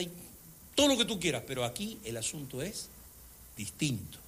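ordinary talk, nothing marked

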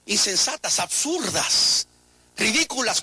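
drawn out. Acted. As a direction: stretch the word slowly in scorn. Drawn out scornful list. (absurdas, muy lento y despectivo)

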